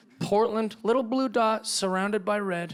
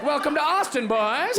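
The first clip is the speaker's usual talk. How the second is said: higher cocky hipster greeting; mock hipster soldier; 8 semitones higher than usual. Hipster at the front line greets invading Texans, cocky. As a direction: welcome them like a swaggering hipster.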